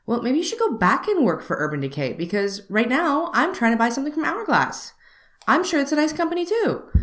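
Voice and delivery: retold sarcastic comeback, building in pitch; sassy comeback. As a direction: put the pushy clerk in her place with a pitch that climbs. Retold snappy comeback to clerk.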